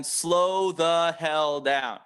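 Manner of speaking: drawn-out, deliberate, every word stretched; slow it right down so each word lands. Shown as a demonstration. Coach to sales reps, modelling a very slow delivery. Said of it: "write that in all bold, all caps".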